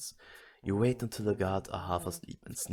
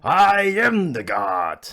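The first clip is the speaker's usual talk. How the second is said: high, drawn-out full-volume scream; huge crazed proclamation; 8 semitones higher, 14 dB louder, much slower than usual. Acted. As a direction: scream it slowly to the whole street. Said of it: "You scream".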